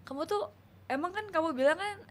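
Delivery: normal talking voice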